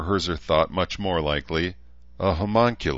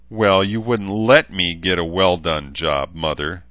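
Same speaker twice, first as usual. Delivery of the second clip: louder, bitter mock fury; spat furiously; 7 dB louder than usual. A narrator's reading. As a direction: spit the words at your mother. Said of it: "she spat furiously".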